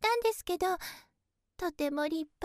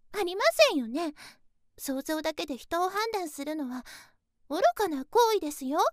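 normal talking voice

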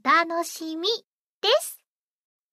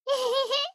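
short embarrassed giggle; shy giggle; 6 semitones higher than usual. Acted. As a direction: giggle bashfully. Giggle at kiss prize.

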